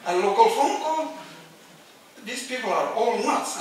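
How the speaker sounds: very high, loud incredulous yell; furious man shouting down the phone; outraged disbelief. Retold or imitated. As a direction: scoff at the absurd excuse. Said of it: "The guy says".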